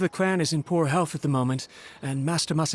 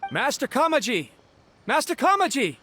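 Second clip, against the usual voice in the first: raised, high call for a missing master; 10 semitones higher than usual. Acted. Servant calling out worriedly for his master. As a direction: call out across the street, searching. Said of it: "We just heard you calling out".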